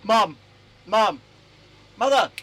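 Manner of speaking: loud call across the house. Real, not acted. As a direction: shout for mum to hear. Calling mum from another room.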